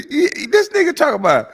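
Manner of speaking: usual speaking voice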